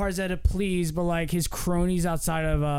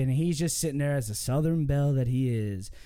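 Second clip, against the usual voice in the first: ordinary delivery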